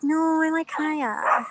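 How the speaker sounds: high, drawn-out mock pleading; playful insistence. Recalling arguing over the dog's name, playfully insistent. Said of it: "I'm like".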